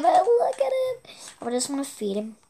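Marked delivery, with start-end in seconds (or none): 0.0-1.1 cooing. Real, not acted. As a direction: coo over a cute pet. Cooing at cute chicken.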